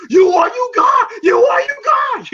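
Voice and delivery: very high mock shout; Rocky. Provoked boxer charging at his rival, hammed-up shout. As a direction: holler it as an enraged fighter charging. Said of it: "you hear Rocky go".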